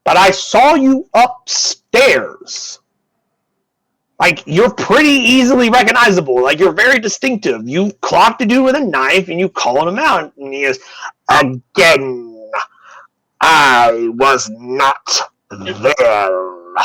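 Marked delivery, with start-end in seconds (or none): 0.0-10.3 high-pitched, incredulous mage voice; high, swooping character voice pressing an accusation; accusing mage. Acted. 11.3-16.8 very slow, stretched-out doctor voice; laboured, deliberate character denial; insistent doctor. Acted. Doctor figure repeats his slow denial.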